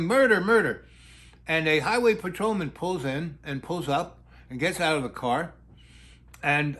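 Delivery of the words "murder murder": mock yelling. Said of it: "she's yelling".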